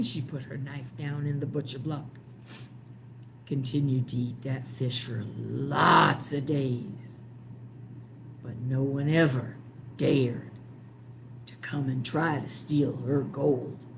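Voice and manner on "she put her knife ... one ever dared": plain reading voice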